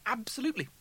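high-pitched breezy denial of trouble; smiling reassurance. A narrator's reading. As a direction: insist with a fixed smile. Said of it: "Rimmer smiled".

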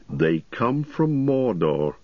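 very slow, weighty pronouncement; measured, slow and grave; low, grave voice. A narrator's reading. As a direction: drag out the words ominously. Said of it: "in a low voice".

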